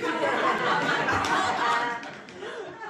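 big, loud burst of laughter; real laughter at the memory of it. Real, not acted. Laughs at her own story.

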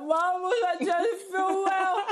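hammed-up poorly child, laughing through it; mock whining plea. Sick woman messaging mum for help, mock whining plea.

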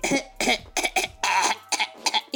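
exaggerated dry fake coughs; mock coughing. Ladies beside him objecting to the vapor, mock coughing. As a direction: imitate pointed, repeated throat-hacking coughs. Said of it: "empiezan a hacerle".

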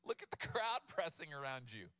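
ordinary talk, nothing marked

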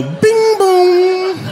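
high sung bing-boom of a doorbell; a mock doorbell chime; doorbell imitation.

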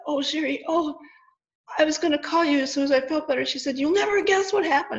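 high, excited retelling of the friend on the phone; imitating sick friend. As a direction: mimic the friend bursting with news. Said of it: "she had a terrible cold".

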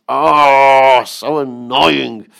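loud, slow whine; annoyed boy. Acted. Five-year-old groaning about picking flowers, loud and slow. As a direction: drag out a bored groan.

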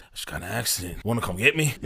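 soft, low, casual understatement; nonchalant. Retold or imitated. Crash victim phones his roommate for a ride, nonchalant. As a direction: play the crash down like it's nothing. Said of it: "mad, like, nonchalant about it".

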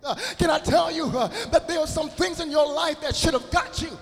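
full-force preaching. Acted. Preacher shouts to the congregation that the Lord protected them. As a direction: build to a roar on the Lord.

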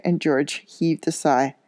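ordinary delivery

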